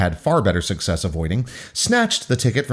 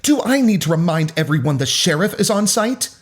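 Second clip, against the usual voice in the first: high-pitched officious warning; authoritative, higher character voice; stern intervening adult; 7 semitones higher than usual. A narrator's reading. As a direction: warn troublemakers with a pointed question. Volunteer warning the fighting crowd.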